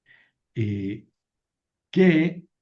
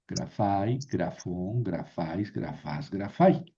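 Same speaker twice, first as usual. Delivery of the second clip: "grafai, grafón, grafais" con acento en la sílaba final; acento en la última; 4 semitones lower, 6 dB quieter, slower than usual. Shown as a demonstration. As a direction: recita el plural cargando el final de "grafón". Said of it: "Siempre va a ir el acento en la última sílaba". ("grafás" aguda, acentuada al final)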